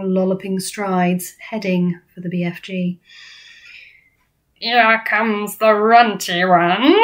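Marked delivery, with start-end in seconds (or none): 4.5-7.0 big drawn-out bully-giant boom. A narrator's reading. Flesh Lump Eater taunting BFG, booming. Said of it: "boomed the flesh lump eater".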